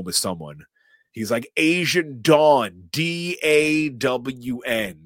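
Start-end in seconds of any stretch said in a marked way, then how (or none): 1.6-5.0 slow and loud, high, spelling letter by letter; dumb deputy spelling. Retold or imitated. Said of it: "This guy is so stupid".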